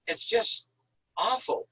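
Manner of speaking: ordinary talk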